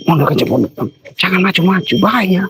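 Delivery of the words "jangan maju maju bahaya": higher-pitched urgent warning; alarmed warning. Real, not acted. Warns companion not to advance, alarmed. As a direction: stop them before they step forward.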